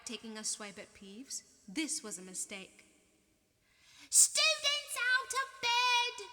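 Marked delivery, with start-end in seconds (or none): 4.1-6.3 high, loud, slow drawn-out bellow; loud high stretched-out yell. A narrator's reading. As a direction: shout to wake the whole castle. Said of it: "Peeves bellowed".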